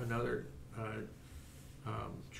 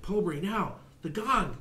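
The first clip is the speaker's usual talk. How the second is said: warrior yelling louder and higher with each cry; a desperate, climbing yell to strike the gong; building shout; 9 semitones higher, 8 dB louder than usual. Acted. GM as warrior screaming at Pobri to hit gong. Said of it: "and the guy's like".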